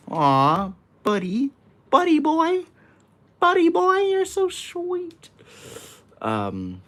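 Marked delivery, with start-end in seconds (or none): 0.1-5.3 put-on very high, squeaky "buddy boy" voice; cutesy baby talk. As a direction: coo at the viewer like a tiny child, slowing at the end. Touched by a viewer's comment.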